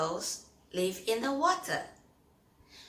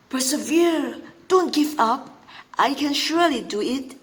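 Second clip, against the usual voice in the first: louder, higher, determined tortoise voice; self-encouraging tortoise; 5 semitones higher, 9 dB louder than usual. Acted. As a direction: pump himself up, Mister Rock pushing on. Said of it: "says Mister Rock, encouraging himself".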